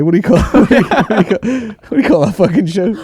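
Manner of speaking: high, loud, breaking up with laughter; real laughter. Real, not acted. Podcaster trying to recall a sitcom name, laughing as he asks. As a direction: laugh through the question. Cracks up searching for show name.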